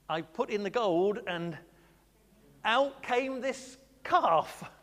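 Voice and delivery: sheepish drawn-out excuse, rising; Aaron making a feeble excuse; Aaron's excuse. Retold or imitated. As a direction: stretch the excuse out lamely.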